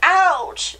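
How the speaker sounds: high, falling ouch; pained mouse yelp. A narrator's reading. Mouse who just fell to the ground, high pained ouch. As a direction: yelp it as the fallen little mouse.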